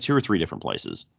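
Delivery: usual speaking voice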